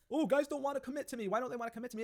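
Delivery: high, whiny voice mimicking women; high, whiny parody of a single woman; whiny mock woman. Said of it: "I always hear about this".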